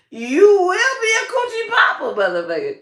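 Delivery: high, loud declaring God voice; God's voice. Retold or imitated. God naming my calling, high and loud. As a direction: announce it like a booming god. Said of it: "God said".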